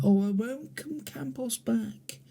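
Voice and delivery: soft, high, whispered whine of a sulking manager; mock the rival manager under your breath; mock whining rival.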